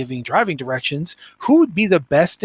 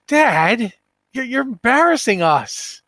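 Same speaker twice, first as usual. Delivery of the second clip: higher, whiny mock teen pleading with dad; embarrassed kid; 7 semitones higher, slower than usual. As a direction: playfully voice a mortified child.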